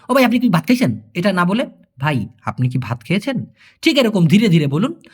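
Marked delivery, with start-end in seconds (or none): 0.1-1.0 "ও ভাই, আপনি কি ভাত খাইছেন?" run together quickly and a bit higher; fast rattled-off question. Shown as a demonstration. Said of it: "ফুরফুর করে কথা না বলে". 2.0-3.5 "ভাই, আপনি কি ভাত খেয়েছেন?" word by word, lower and softer; slow broken-up speech. Shown as a demonstration. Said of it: "ভেঙে ভেঙে বলতে".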